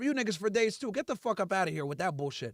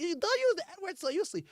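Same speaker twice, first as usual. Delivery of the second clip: high whiny mocking voice, pitched up; mocking a commenter; 9 semitones higher than usual. Mocking a viewer who scolded his language, high and whiny. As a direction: parrot it back like a scold. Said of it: "Someone said".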